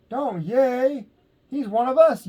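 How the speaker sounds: high, loud protest; yelling. A narrator's reading. Pip yelling at the cheering crowd to stop. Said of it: "yelled Pip".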